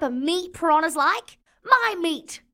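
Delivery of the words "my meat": sharp, higher outraged cry; high indignant shout; outraged yell. Acted. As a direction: yell it in offended panic. Outraged at piranha gift.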